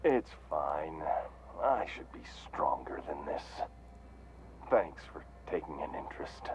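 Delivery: low, subdued self-reproach; dejected. Acted. Accepts apology after breaking down.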